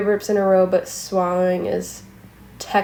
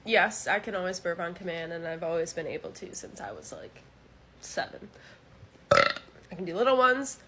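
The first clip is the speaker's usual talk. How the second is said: quiet; 2 semitones lower, 8 dB quieter than usual. Real, not acted. Hushed because of people nearby.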